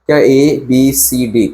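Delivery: ordinary delivery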